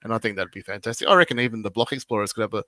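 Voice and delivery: usual speaking voice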